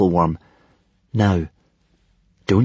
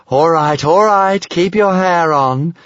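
raised, drawn-out placating voice; smiling tease; 7 semitones higher, 4 dB louder, slower than usual. A narrator's reading. Stormfly calms howling Hiccup, smiling and teasing. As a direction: soothe them with a teasing smile. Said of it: "smiled the Stormfly".

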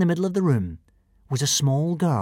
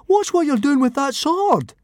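high-pitched indignant protest; 13 semitones higher, 4 dB louder than usual. A narrator's reading. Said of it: "objected Fishlegs indignantly".

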